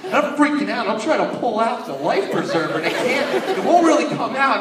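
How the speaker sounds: ordinary talk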